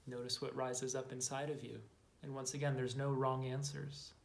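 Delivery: measured, calm inner-journey guidance with long silences; guided meditation. Acted. Guide to listeners exploring their inner saboteur, calm meditation voice. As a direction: invite quiet noticing, letting phrase endings settle softly.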